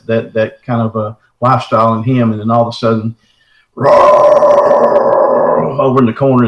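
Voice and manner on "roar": breathy mock roar, high and loud; imitated roar. Telling the Bigfoot encounter, a breathy imitated roar. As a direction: blow out a creature's roar to scare the listener. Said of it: "this thing stands up".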